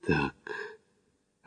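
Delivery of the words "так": low, soft, worried breath; preoccupied exhale. A narrator's reading. Макдональд guessing the rest, worried. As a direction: breathe it out with concern. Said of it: "озабоченно выдохнул Макдональд".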